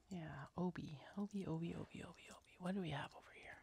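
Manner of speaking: whispered to herself under the breath; whispering. Real, not acted. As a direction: whisper it like thinking aloud. Searching, talking to herself.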